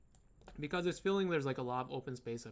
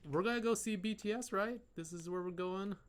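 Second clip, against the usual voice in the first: high, eager fangirl voice; bubbly fan off to a concert; excited anime fan; 6 semitones higher than usual. Pretend. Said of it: "he's like".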